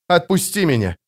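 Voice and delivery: curt demand to be released; sharp. A narrator's reading. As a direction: order it abruptly, with steel. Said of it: "резко сказал он".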